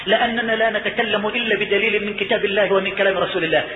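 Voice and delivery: ordinary talk, nothing marked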